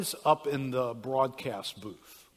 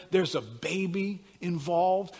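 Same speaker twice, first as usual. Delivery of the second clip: ordinary talk, nothing marked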